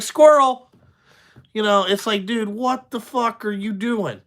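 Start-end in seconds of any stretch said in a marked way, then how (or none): 0.0-0.7 distracted squirrel shout. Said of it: "you're like".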